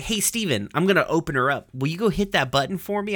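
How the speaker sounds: high, perky little character; Navy. Retold or imitated. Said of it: "and then Navy's like".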